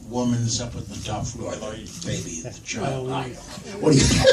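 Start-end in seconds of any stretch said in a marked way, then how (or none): none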